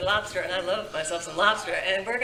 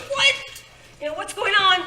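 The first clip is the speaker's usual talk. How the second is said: startled; 10 semitones higher than usual. Retold or imitated. Retold startled glance.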